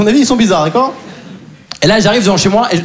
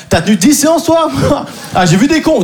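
ordinary talk

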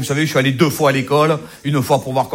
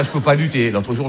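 usual speaking voice

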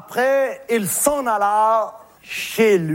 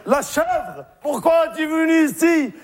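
a high dismayed cry to the heavens; lightly voiced shepherd calling out in despair; 6 semitones higher than usual. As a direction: Monsieur Seguin crying out over his goat. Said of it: "Il s'écria".

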